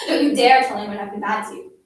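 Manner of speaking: loud, forceful threat; threatening warning. A narrator's reading. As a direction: warn the child off hard. Bully threatens the girl.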